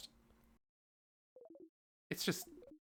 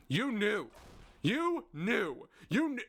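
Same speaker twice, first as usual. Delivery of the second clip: accusing; 7 semitones higher, much slower than usual. Acted. Siffrin accusing Loop of lying, raised and hurt. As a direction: throw it at him.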